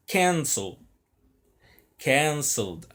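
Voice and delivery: "cancel" and "Canceled" punched on CAN, falling away after it; first-syllable stress. Shown as a demonstration. Teacher shows students the tonic syllable at the start, first-syllable stress. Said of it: "a sílaba tônica é no começo".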